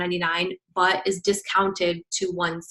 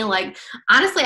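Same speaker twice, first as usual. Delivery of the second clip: ordinary talk, nothing marked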